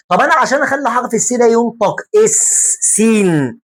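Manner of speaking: usual speaking voice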